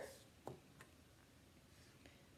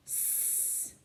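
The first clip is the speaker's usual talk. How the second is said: whispered sound; 15 dB quieter than usual. Shown as a demonstration. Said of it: "The first sound is".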